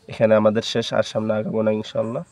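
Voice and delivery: ordinary delivery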